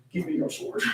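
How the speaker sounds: soft unvoiced ask; hushed request. Reading Mina's odd request, whispered. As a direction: whisper it like a secret.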